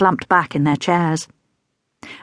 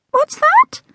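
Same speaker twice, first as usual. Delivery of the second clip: much higher, bright call from below; dotty teacher; 4 dB louder than usual. A narrator's reading. Scatty teacher calling from under the tables, much higher. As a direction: pop up with a high startled question.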